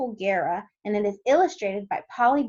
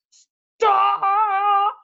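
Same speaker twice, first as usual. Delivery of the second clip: long, high, loud holler; full-voiced commanding shout; 10 semitones higher, 7 dB louder than usual. A narrator's reading. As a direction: bellow one drawn-out word to halt a quarrel. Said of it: "the chief frog shouted out".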